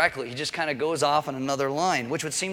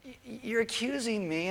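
put-on wounded kid; 7 semitones higher, 6 dB quieter, slower than usual. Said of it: "And you're like".

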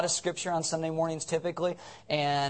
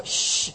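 long unvoiced "shh"; drawn-out shush. Real, not acted. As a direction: hush the whole room. Hushes the congregation.

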